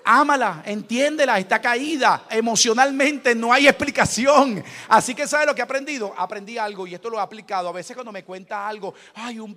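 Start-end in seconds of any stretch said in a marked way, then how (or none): 0.0-6.1 loud fervent sermon cry; shouting pulpit preaching; preaching at full force. Acted. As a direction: preach it to the whole church, full voice.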